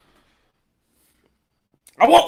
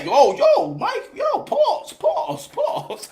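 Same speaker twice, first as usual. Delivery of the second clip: high squeaky pause; 13 semitones higher, 3 dB quieter, much slower than usual. Retold or imitated. The boy nervously telling Mike to say pause, very high and squeaky. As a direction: voice jumps very high and fades softer on each pause. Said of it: "You be his boy and be like".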